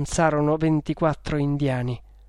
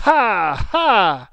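two loud, high, separate "ha"s; big mocking laugh; acted scornful laugh; 5 semitones higher, 9 dB louder than usual. A narrator's reading. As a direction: fling out a defiant stage laugh. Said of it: "esclamò ridendo".

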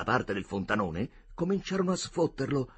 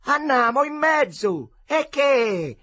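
shouted high and slow, insistent; calling out; 12 semitones higher, 7 dB louder, slower than usual. Acted. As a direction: yell it over the water. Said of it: "ripeteva il Riccetto". (grido insistente)

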